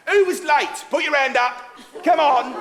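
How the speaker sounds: loud, punchy music-hall heckle; pretend-stern shout to the back rows; mock telling-off. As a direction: call out the latecomers with playful bossiness. Chairman mock-scolds latecomers in audience.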